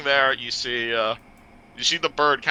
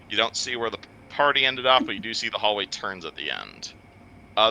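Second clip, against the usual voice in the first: usual speaking voice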